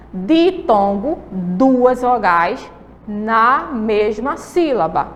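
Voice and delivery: very slow, deliberate summary; slow recap. Shown as a demonstration. Teacher to pupils, slowly summing up the rule. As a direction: lay each word down slowly. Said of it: "pra facilitar".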